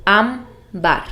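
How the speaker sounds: ámbar with the first syllable ÁM leaned on, bar falling away; stress on ÁM. Shown as a demonstration. Teacher showing students the stressed syllable ÁM of ámbar. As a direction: say ámbar slowly, pressing ÁM. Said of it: "tiene el acento en la sílaba am".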